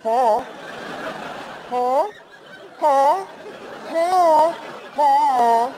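high, loud come-on calls; hammed-up calls, each pitched high and held; mock street hooker. Comic luring a bear with a marshmallow, mock hooker come-on. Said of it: "like a street hooker".

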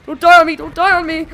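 loud, high, urgent pleading; urgent plea. Real, not acted. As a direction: shout it to a falling teammate. Pleading with a dying teammate.

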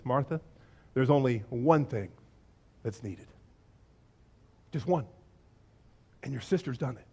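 low, soft and spaced out; hushed, whisper-like. Acted. Jesus gently tells Martha one thing matters, hushed. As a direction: drop a quiet truth gently. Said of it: "he whispers and he says this".

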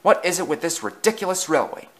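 exasperated exclamation. A narrator's reading. Cross passenger demanding answers about the railway, exasperated exclamation. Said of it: "exclaimed a man".

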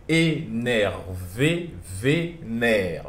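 énervé and vénère drawn out slowly in separate syllables; teacher slowly spelling out the sounds of "énervé" and "vénère". Shown as a demonstration. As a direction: break "énervé" into slow syllables, then flip it into "vénère". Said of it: "Olha bem".

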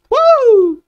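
loud, high-pitched "woo"; high whoop. Real, not acted. Whoop while playing.

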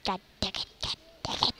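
soft frustrated mutter; quiet annoyed grumble. Real, not acted. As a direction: mutter it to yourself.

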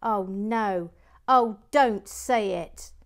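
loud, drawn-out cry of dismay; dismayed wail. Real, not acted. Host realising she has botched Michael's message, loud dismay.

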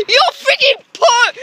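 high laughing wail; laugh-crying outrage. Real, not acted. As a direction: crack up mid-complaint.

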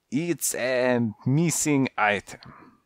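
put-on slow, careful French accent; French cat choosing words slowly. Visiting cat explains his errand in a slow French accent.

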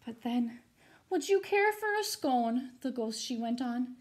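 put-on lively rhyming read-aloud for kids; storybook reading. A narrator's reading. Teacher reading a rhyming ghost story to her students, animated. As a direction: read it to the class with bounce and drama.

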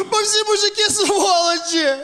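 tearful, drawn-out whining; despairing wail. Acted. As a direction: wail it out like a melodramatic plea. Despairing that he doesn't text back.

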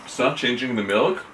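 chewing between words; talking through a mouthful; mouth full of cereal. Real, not acted. Seen 1: Red-shirt speaker takes spoonful, chews, then talks with food in mouth.

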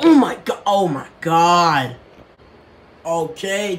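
ordinary talk

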